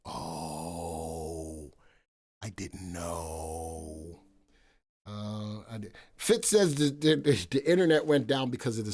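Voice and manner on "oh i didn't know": low, very slow, softer mock-innocent drawl. Streamer retelling his reply to the boss, low and slow. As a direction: play dumb, dragging it out low and soft. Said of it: "I was like".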